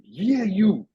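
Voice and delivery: high-pitched imitation of a heckler; single someone out, pitched high; mock taunt. Retold heckler singles him out.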